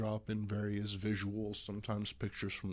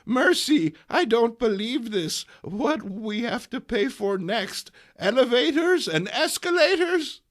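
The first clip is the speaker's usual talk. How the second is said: much higher, louder outraged granny; scandalised old lady; 12 semitones higher, 11 dB louder than usual. Acted. Aunt May inside the ladies' room, high loud outrage. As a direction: wail at the injustice like an old aunt.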